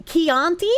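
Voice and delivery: "Chianti" as kee-AHN-tee, rising to check; corrected pronunciation. Shown as a demonstration. Streamer checking chat's correction, "Chianti" said kee-ahn-tee. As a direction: say "Chianti" with a hard k, as a question.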